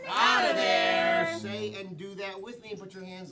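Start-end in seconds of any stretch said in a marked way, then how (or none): 0.1-1.4 loud, drawn-out game-show call; high, booming group chant; elimination shout. Shown as a demonstration. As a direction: shout it slowly with the crowd. Host leads crowd in elimination chant.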